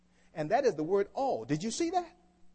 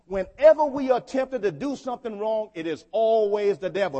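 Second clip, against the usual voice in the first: loud, raised preaching voice; emphatic repeat; 7 dB louder than usual. Real, not acted. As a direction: drive it home to the congregation, louder. Repeats it after asking if they listen.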